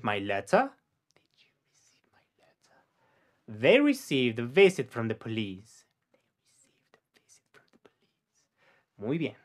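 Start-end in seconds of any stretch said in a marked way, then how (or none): 1.1-2.8 faint whispered echo of "Did you receive my letter?". Shown as a demonstration. Teacher whispering the sentence back for the learner. 6.4-8.1 faint whispered echo of "They received a visit from the police."; voiceless, barely audible repeat. Shown as a demonstration. Teacher whispering the model sentence for the learner.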